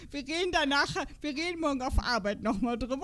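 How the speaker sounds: speaking through leftover laughter; laughing. Real, not acted. Postponing the trip talk with a friend, laughing. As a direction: still chuckling as you talk.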